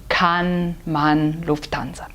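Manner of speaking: kann man Lufthansa with the a wide open, slow. Shown as a demonstration. Teacher modelling the German open a. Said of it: "que tem que ser aberto".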